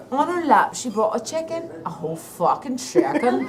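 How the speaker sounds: lightly Irish, laughing through it; a hint of Irish, chuckling; Irish accent. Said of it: "in an Irish accent".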